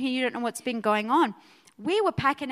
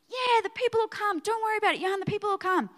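breezy reassurance; 7 semitones higher, faster than usual. Retold or imitated. Reassuring the project manager.

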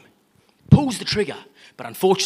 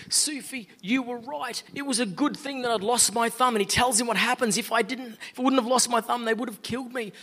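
excited gratitude; 7 semitones higher than usual. Acted. King overjoyed, grateful.

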